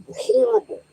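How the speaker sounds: high mocking "horrible"; sarcastic. Sarcastic self-mockery.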